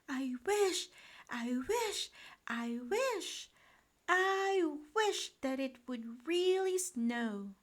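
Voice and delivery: put-on high, fervent child's wish; wishing William. A narrator's reading. Boy wishing on the snow globe, fervent. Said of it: "he said".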